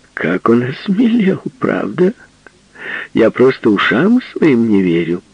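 high, loud teasing; mock his new boldness; teasing woman.

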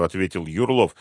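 usual speaking voice

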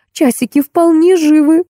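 high-pitched, cheered-up nanny voice; heartened. A narrator's reading. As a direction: perk right up, lifting the pitch high. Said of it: "воспряла духом няня". (приободрившись, высоким голосом)

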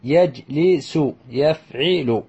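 يجلس يفعل slowly as yaj-li-su, yaf-'i-lu; teacher's drawn-out model of يجلس يفعل with a clear I; syllable by syllable. Shown as a demonstration. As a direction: sound out يجلس يفعل in separate beats. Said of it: "يكون بكسر العين".

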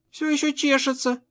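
put-on high, soft child voice; маленькая дочь. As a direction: complain quietly to daddy as a little girl. Дочь жалуется папе на зуд. (тихий жалобный детский голосок)

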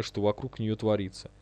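plain reading voice

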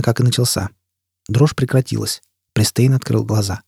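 usual speaking voice

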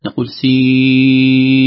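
سين held long, very slow, half sung; six-count elongation. Shown as a demonstration. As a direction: stretch the vowel of سين over six beats. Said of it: "تمد مدا لازما، ست حركات".

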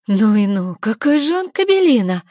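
high, loud outraged exclaim. Acted. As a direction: burst out in disgust. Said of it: "восклицает Синди".

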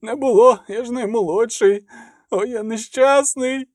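high, whining self-pity; tearful lament. A narrator's reading. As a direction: wail like a wretched young novice. Weeping novice laments his lot.

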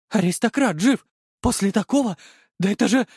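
high-pitched disbelief; stunned amazement. A narrator's reading. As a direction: marvel that the victim is alive. Killers amazed at survival. (ошеломлённо, высоким голосом)

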